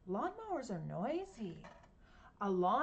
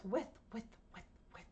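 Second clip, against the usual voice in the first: breathy with-with, fading to a whisper; sprinkler sound; 5 dB quieter, much faster than usual. Shown as a demonstration. Showing the class a sprinkler: soft with with. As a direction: spit it out like a turning sprinkler. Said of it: "they go like this".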